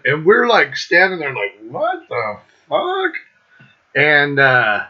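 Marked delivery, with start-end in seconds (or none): 1.6-3.1 high, slow, drawn-out disbelief; mock stunned. Friends watching the bomber get caught, drawn-out high disbelief. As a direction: stretch it out like you can't believe it.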